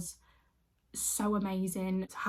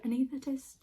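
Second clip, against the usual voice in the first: ANAESTHETIST said higher, rising like a question; unsure questioning; 5 semitones higher than usual. Real, not acted. New mother checking a medical word, ANAESTHETIST as an unsure question. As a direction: query the word ANAESTHETIST with a raised, rising pitch. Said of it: "Is that the word for it?".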